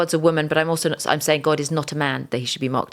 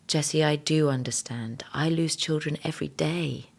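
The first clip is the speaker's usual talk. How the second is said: softly whispered comfort; gentle whisper; 5 dB quieter than usual. Retold or imitated. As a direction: whisper it like a consoling voice. God answering her gently.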